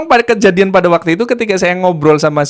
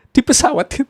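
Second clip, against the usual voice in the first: amused, higher-pitched repeat; laughing echo; 5 semitones higher than usual. Retold or imitated. Storyteller echoing the nephew's odd answer, laughing.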